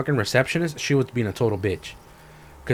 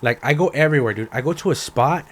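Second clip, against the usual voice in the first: normal talking voice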